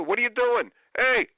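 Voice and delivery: imitating a parent yelling at kids; mock yell. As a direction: snap at the kids mid-rant.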